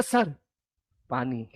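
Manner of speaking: sweetly offered. Retold or imitated. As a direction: hand it over with fake tenderness, barely above a breath. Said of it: "उसी के पास इतने प्यार से बोले".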